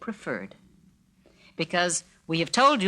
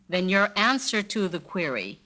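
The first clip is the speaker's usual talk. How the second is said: ordinary talk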